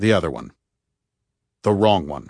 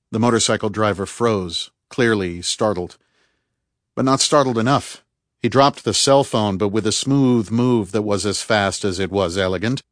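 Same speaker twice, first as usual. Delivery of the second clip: plain reading voice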